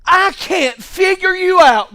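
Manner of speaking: high, loud cry to God; full-voiced pulpit shout; preaching shout. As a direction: shout it at the heavens.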